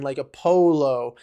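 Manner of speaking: ordinary talk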